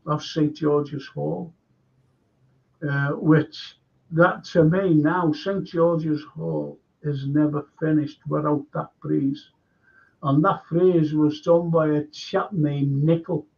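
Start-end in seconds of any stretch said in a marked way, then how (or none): none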